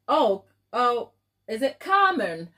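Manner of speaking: ordinary delivery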